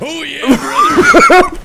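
loud, slow Hogan growl of brother; Hulk Hogan impression. [name] turning into Hulk Hogan, loud slow wrestler call. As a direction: drag out oh yeah brother like a wrestler. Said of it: "turning into Hulk Hogan".

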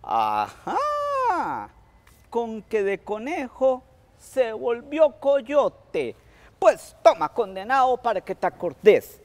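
high, loud angry scolding, the first words slow; the old woman punishes Tío Coyote, high and loud with fury; furious old woman. Acted. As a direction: spit it out as she pours the water.

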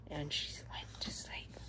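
soft unvoiced whisper. A narrator's reading. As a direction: hush it like she's sleeping. Goldilocks falls asleep in story.

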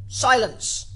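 high, loud royal order; cackled out. A narrator's reading. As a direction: cry out for silence in court. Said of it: "cackled out".

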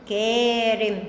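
"كارم" with a long drawn-out aa after the k; long vowel demo. Shown as a demonstration. Teacher showing alif of prolongation, "كارم" with long aa. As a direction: stretch the first vowel of "كارم". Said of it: "الكاف فتحة وألف مد".

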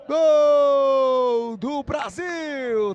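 long held goal shout, high and loud; explosive goal commentary. Real, not acted. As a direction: stretch out the goal call at full power.

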